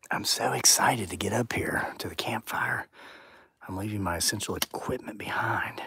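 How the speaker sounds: hushed whisper while walking in the dark; whispering out in the woods at night. Real, not acted. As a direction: keep it to a breathy whisper so nothing hears you. Whispering while fetching tripod.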